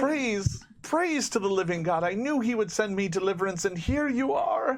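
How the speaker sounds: high-pitched, rapturous praise; zealous farm woman. Acted. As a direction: gush with devout gratitude.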